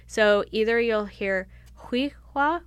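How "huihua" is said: "Huihua" in two halves, stopped between; Hunkaminam pronunciation. Shown as a demonstration. Showing listeners the Hunkaminam way, "Huihua" with a glottal stop. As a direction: say "Huihua" with a glottal stop midway, breathy throat sound. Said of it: "our name said two different ways".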